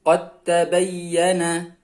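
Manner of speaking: "Qad tabayyana" slowly, as qat-tabayyana; dal merged into ta. Shown as a demonstration. Teacher shows dal disappearing, slow merged recitation. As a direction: drop the d of "Qad" into a doubled t. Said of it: "Di sini dalnya hilang".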